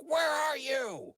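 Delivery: high, slow call into the dark. Acted. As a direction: call out searching the room. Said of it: "And he's like".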